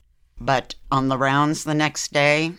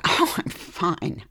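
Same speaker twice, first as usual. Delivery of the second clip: breathless dismissal; 6 semitones higher, slower than usual. Retold or imitated. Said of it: "And I said".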